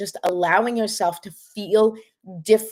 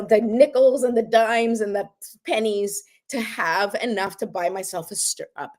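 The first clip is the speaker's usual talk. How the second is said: ordinary talk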